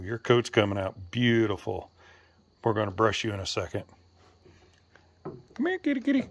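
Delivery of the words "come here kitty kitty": high sing-song kitty call; high-pitched coaxing call to the cat. Real, not acted. Calling the cat.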